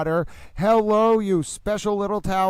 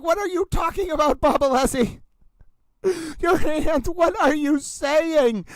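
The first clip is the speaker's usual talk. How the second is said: much higher, mock-baffled, slowing at the end; incredulous retort; 8 semitones higher than usual. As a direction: play it as a disbelieving comeback to the lawyer. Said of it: "I was like".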